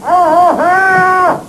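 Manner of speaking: high, drawn-out muffled reply; mouth full. Acted. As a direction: insist through a stuffed mouth. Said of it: "Don't talk with your mouth full".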